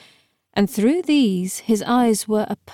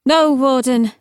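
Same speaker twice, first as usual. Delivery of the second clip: higher, louder answering call; calling back; 5 semitones higher, 5 dB louder than usual. Acted. Camilla calls back from the stairs.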